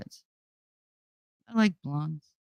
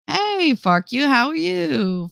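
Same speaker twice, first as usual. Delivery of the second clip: high, slow, sing-song greeting; sweet high voice, drawn out; high mock greeting; 11 semitones higher, slower than usual. Mock greeting to chat member.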